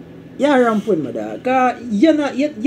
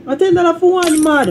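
mock squeaky protest; 11 semitones higher than usual. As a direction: squeal it like a cartoonish complainer. Protesting donation amount, mock voice.

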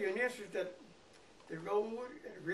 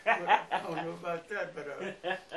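chuckling through the words; laughing; 9 dB louder than usual. Real, not acted. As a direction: laugh it off, amused. Laughs after teasing remark.

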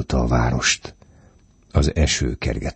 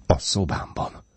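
higher, breath-filled near whisper; alarmed stammer; 5 semitones higher, 4 dB quieter than usual. A narrator's reading. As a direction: say it half-voiced, startled. (riadtan, félig suttogva)

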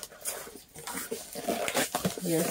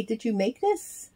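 ordinary talk, nothing marked

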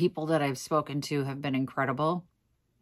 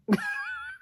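giggle. Real, not acted. Tired giggle over earning cookie.

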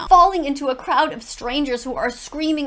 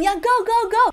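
higher, louder imitation of a cheering fan; teacher mocking fans who scream at games; 8 semitones higher, 4 dB louder than usual. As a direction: cheer it like a sports fan in the stands. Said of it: "they scream".

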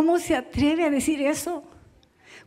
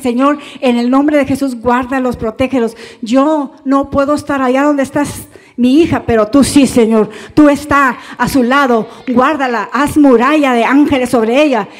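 louder, pleading prayer to the Lord; a parent's earnest prayer, raised voice; fervent prayer; 6 dB louder than usual. Shown as a demonstration. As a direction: pray it out boldly for your children. Model prayer for children.

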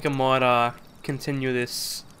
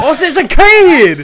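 high, very loud, drawn-out yell; slow, blaring cry of surprise; startled shout; 13 semitones higher, 15 dB louder, much slower than usual. Real, not acted. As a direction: shout out a sudden discovery. Startled discovery of a child player.

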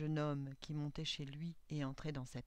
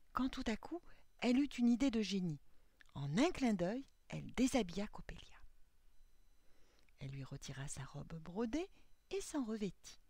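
ordinary delivery

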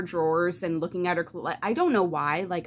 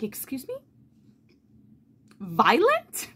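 incredulous; 6 semitones higher, 4 dB louder than usual. Retold or imitated. Reacting to absurd book line.

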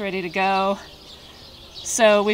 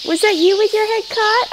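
much higher sing-song pet voice; 4 dB louder than usual. Real, not acted. Farmer asking a calf if its head was caught, high baby talk.

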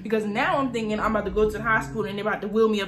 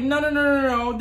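panicked pleading; 4 semitones higher, 5 dB louder than usual. Retold or imitated. Begging sister, ankle hurt.

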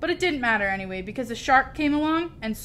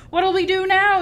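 high, loud alarmed question; yelling; 10 semitones higher, 8 dB louder than usual. A narrator's reading. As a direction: yell for help over a storm. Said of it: "yelled one of the pirates".

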